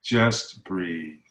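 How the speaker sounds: low, soft soothing mother; calm a worked-up kid gently; a mother's hushed reassurance to her son; mom soothing. Retold or imitated. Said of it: "And she would say".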